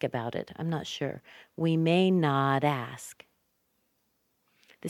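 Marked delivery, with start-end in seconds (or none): 1.6-3.2 slow, stretched "may not ask"; teacher's slowed model of "may not ask"; slow linked demo. Shown as a demonstration. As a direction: draw out "not ask", joined by a tapped d. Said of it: "The T sounds like a D there".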